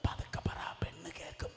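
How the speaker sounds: imitating a wife's hushed whisper; wife hushing her husband, whispered. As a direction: whisper it so the daughter won't hear. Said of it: "ഭാര്യ ഭർത്താവിനോട് ഇങ്ങനെയാണ് പറയുന്നത്".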